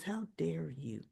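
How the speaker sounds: low, soft and slow, cold disbelief; quiet slow indignation; hushed how dare you. Retold or imitated. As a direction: let it drop quietly and slowly. Retold soap quarrel, Sasha.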